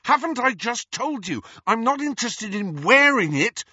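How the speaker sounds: high, loud exasperation; fierce. A narrator's reading. Harry cuts off the ghost's lecture, fierce. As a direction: snap at them for not listening. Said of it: "Harry said fiercely".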